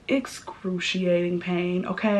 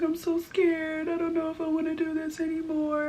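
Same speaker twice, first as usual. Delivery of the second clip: a teary, trembling "I'm so scared"; retold weeping; 9 semitones higher, 5 dB quieter than usual. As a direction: replay the scared, crying girl on the table. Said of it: "I, like, start weeping".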